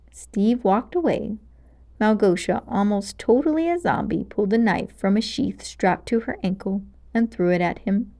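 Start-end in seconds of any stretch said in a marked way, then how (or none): none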